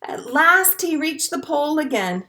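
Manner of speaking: gentle storybook read-aloud; picture-book narration for a class; reading to children. A narrator's reading.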